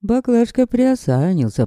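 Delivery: ordinary delivery, nothing marked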